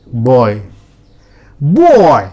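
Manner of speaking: put-on boy said with a full o-to-y glide; teacher drilling boy; oy sound demo. Shown as a demonstration. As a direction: stretch the oy in boy. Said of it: "You have to learn oy".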